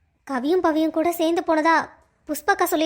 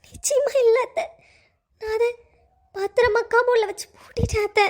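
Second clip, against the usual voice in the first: tearful whisper; 5 semitones higher than usual. Acted. Raji assures aunt.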